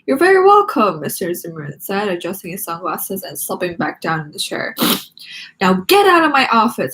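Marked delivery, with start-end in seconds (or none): 0.1-1.0 much higher, louder reply; a teacher's brisk welcome; voiced teacher. Teacher answering Nikki's thanks, higher and louder. 5.6-6.9 louder grumpy dismissal; grumpy order. Acted. Teacher dismissing Nikki again, grumpy and loud.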